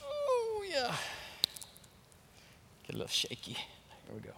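a shaky, almost voiceless aside; nervous hush. Real, not acted. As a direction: hush as if steadying real nerves. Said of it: "I'm getting nervous before I say this".

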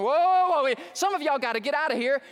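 loud, high mock-alarmed whoas; freaked-out nurse. Nurse halting a crowded hospital room, freaking out. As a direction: call a halt like a panicking nurse. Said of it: "the nurse was freaking out".

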